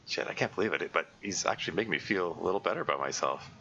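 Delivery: ordinary delivery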